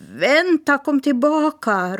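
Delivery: calling out. Acted. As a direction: shout after the departing bird. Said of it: "ropade Smirke".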